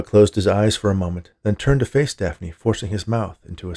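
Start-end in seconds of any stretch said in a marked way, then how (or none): none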